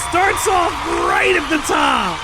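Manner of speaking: high, slow, loud cheering; excited yelling. Real, not acted. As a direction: whoop at the screen like a fan. Cheering a cutscene fight.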